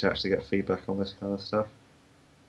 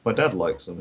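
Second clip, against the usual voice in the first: usual speaking voice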